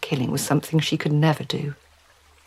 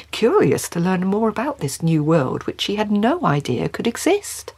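ordinary delivery

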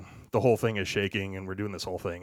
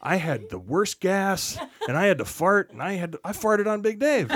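gleeful confession; 8 semitones higher than usual. Retold or imitated. Jeff gleefully confessing at lunch.

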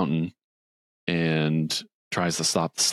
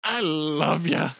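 high, slow mock-weepy gush; mock-teary Grinch hugging his dog; 10 semitones higher, much slower than usual. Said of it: "he looks over at Max and he goes".

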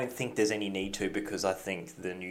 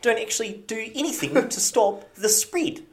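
put-on high-pitched New Zealand accent; exaggerated Kiwi accent; 13 semitones higher, 6 dB louder than usual. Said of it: "that was my Kiwi accent".

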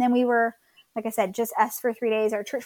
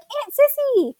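put-on high, louder little-kid squeal; delighted toddler; 14 semitones higher, 6 dB louder, slower than usual. Mother voicing her two-year-old greeting his aunt, delighted. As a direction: imitate a small boy thrilled to see his aunt.

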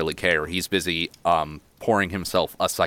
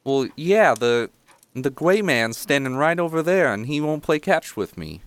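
put-on high-pitched small boy; little kid explaining, voice pitched up; youngest child; 8 semitones higher than usual. Little boy telling a visitor about the gray man, childlike. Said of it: "the youngest one".

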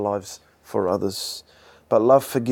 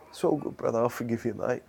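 gentle forgiving; 9 dB quieter, faster than usual. Acted. Acted forgiving reply.

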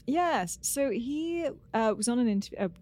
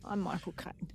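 whispered Cockney self-introduction; breathy Cockney whisper of the name; whispered Michael Caine; 9 dB quieter than usual. Retold or imitated.

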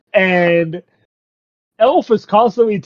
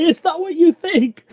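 high flustered protest; hammed-up high denial; mock panic; 13 semitones higher than usual. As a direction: squeak it out in a fluster. Flustered guy caught by brother.

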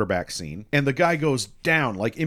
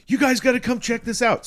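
higher, louder excited summons; urgent call; 12 semitones higher, 5 dB louder than usual. Retold or imitated. Maddie urging friends to see the chaos, excited. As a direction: hurry them over, excited.

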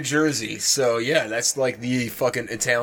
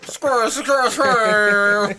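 very high, loud squeaky squeal; 9 semitones higher, 6 dB louder, slower than usual. Pretend. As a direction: squeal it like a cartoon critter. Shrill squeak laughing bit.

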